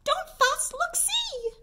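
very high little mouse voice, soothing; squeaky mouse. A narrator's reading. Mouse comforts the crying bear in a high squeak. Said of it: "Mouse squeaks".

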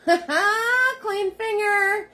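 a goofy high-pitched giggle repeat; silly squeaky voice. Pretend. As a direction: squeak out "Clean finger" slowly, giggling.

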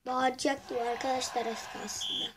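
ordinary talk, nothing marked

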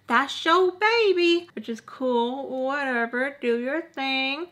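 high, drawn-out sing-song dismissal; mock breezy indifference. Telling parents it's their kid, mock carefree drawl.

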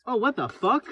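ordinary talk

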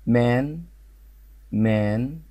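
مان with a long open vowel, rising at the end; model pronunciation. Shown as a demonstration. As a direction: present مان as a word to copy, stretching the vowel. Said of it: "نشوف الكلمة دي".

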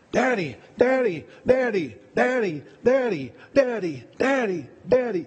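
put-on high, insistent little kid calling out; child calling daddy. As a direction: nag like a small child tugging at a parent on the phone. Imitating his child interrupting a call.

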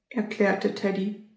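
usual speaking voice

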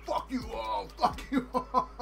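high, laughing mock dismissal. Grower tearing out unwanted hemp sprouts, laughing dismissal. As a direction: tell the sprouts off through laughter. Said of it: "was just like".

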